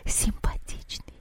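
soft sighing whisper; breathy whisper. A narrator's reading. Water spirit sighing after the soldier, whispered. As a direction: breathe it out admiringly. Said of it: "пронёсся над водой вздох".

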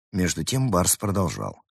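ordinary delivery

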